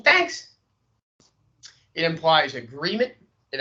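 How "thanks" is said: thanks jumped very high; thanks lifted way up at the end; a high rising thanks; upward inflection. Shown as a demonstration. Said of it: "with an upward inflection".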